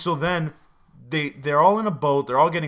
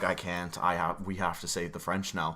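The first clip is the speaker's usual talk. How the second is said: quiet resolve; 7 semitones lower, 10 dB quieter than usual. Retold or imitated. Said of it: "He's like".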